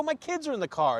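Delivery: high-pitched retold plea; acted-out indignation; indignant protest. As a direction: protest it high, without shouting. Acted confrontation continues.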